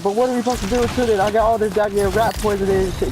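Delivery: high, fast worried mom; quick high mom objecting. Retold or imitated. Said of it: "she was like".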